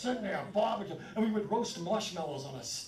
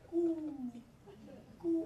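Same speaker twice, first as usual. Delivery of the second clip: pigeon coo imitation; 9 semitones higher than usual. Comic imitating the pigeons' song, mock high coo. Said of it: "It was like".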